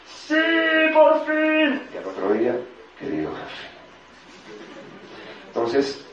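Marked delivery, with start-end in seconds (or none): 0.0-1.8 very high, stretched cheer; jubilant relief. Acted. Runner celebrates finally deciding to quit, jubilant relief. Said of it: "salí corriendo como diciendo". 3.0-3.7 soft, low and deferential; meek address. Acted. Employee addresses the boss next day, meek.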